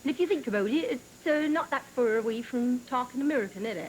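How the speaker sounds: rural West Country English accent; broad West Country English. Shown as a demonstration. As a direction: talk like a local from the west of England. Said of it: "in the West Country of England".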